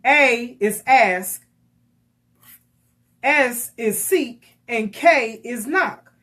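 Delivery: slow deliberate A is ask, S is seek, K is knock; slow sermon-style acronym breakdown; spelled acronym. Shown as a demonstration. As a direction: lay out each letter slowly like a lesson. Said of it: "teaching acronym slowly".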